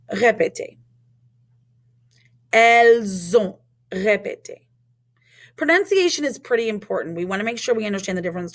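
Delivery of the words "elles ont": "Elles ont" slow and louder, linked with a z; z liaison. Shown as a demonstration. Teacher modelling for repetition, "Elles ont" slowly with z liaison. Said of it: "cause it to be a Z sound".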